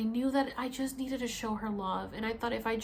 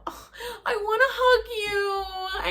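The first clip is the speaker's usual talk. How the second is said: high, loud, drawn-out laughing whine; retold laughing wail; 11 semitones higher, 6 dB louder, slower than usual. Retelling wanting a hug after falling, laughing high wail. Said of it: "I start laughing, and I go".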